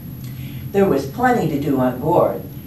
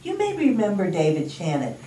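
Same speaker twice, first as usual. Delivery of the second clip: ordinary delivery, nothing marked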